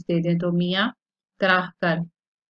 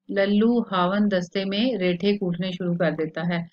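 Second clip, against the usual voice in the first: usual speaking voice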